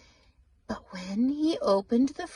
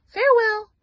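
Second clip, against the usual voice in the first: higher, louder bright little mouse; a cheerful tiny creature bouncing off; chirpy mouse; 10 semitones higher, 9 dB louder, faster than usual. Acted. Mouse leaving.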